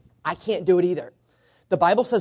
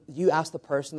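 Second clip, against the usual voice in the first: ordinary talk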